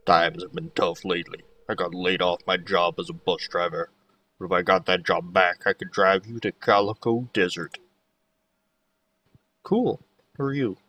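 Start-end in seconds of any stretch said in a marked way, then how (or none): 0.0-7.8 lower-pitched character voice; Pam, out-of-work bus driver. Acted. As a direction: voice a down-on-her-luck bus driver, low. Reads Pam's game dialogue in voice.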